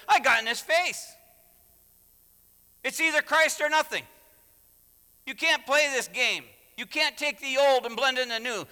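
loud, high, forceful rebuke; Paul confronting bluntly. Acted. Preacher voices Paul getting in Peter's face, loud and blunt. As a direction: lay it down hard, phrase by phrase. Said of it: "Paul says very plainly".

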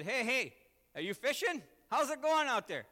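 loud, high holler across the water; calling out. Acted. Preacher hailing fishermen from shore, calling out. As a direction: hail someone out fishing. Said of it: "if I called out to you".

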